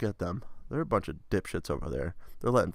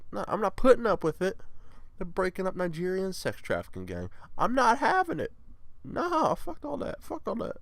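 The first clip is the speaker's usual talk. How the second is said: mock outrage, pitched way up; high-pitched refusal; 10 semitones higher than usual. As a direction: protest in a squeaky-high register. Mock outrage at trafficking gang.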